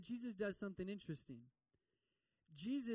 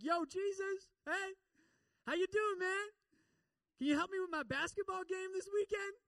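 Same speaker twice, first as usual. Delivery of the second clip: much higher, louder slacker voice; mock casual dude; 12 semitones higher, 7 dB louder than usual. Preacher mocks a kid chatting to Jesus like a pal, high and loud. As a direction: ham up a too-casual kid greeting a buddy.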